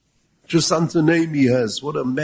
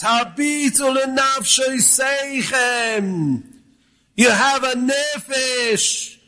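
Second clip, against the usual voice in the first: slow, raised rebbe exhortation; fiery preaching; 5 semitones higher, slower than usual. Acted. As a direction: urge the crowd to look into their souls. Preaching at full force.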